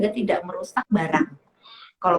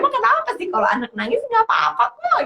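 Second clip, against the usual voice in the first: mock incredulous question; 14 semitones higher than usual. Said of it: "saya tanya".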